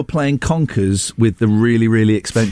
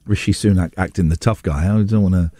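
ordinary delivery

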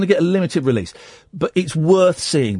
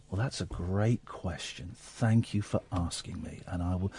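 gentle Mister Rogers; 8 semitones lower, 12 dB quieter than usual. Retold or imitated.

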